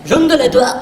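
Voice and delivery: gargle the French sounds; mock French. Mocking French, high hammed-up accent. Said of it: "But French is like".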